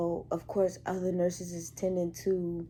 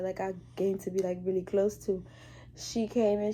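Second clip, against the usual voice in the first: normal talking voice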